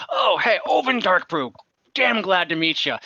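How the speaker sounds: high, hearty dwarf welcome; enthusiastic dwarf. Acted. Dwarf shaking hands with the party, high and hearty. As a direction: pump every hand with gusto. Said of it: "he's very enthusiastic".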